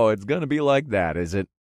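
amused, chuckled comeback; light chuckle. A narrator's reading. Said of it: "I chuckled".

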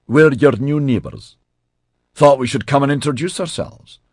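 voiced with a broad Scottish accent. A narrator's reading. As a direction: speak in a thick Scottish accent. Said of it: "in a broad Scottish accent".

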